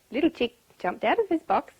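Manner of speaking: usual speaking voice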